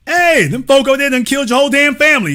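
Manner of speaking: high, fast, alarmed; urgent acted plea. A voiced villager telling another, fast and high. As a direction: warn him with a hammed-up shout.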